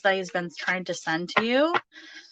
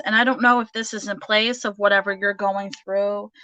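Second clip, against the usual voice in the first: ordinary talk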